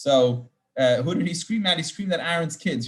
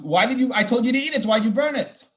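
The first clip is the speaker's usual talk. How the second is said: higher, louder indignant questioning; angry Moses; 6 semitones higher, 4 dB louder than usual. Retold or imitated. Moses confronting Aaron's sons, angry higher questioning. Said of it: "and even got angry".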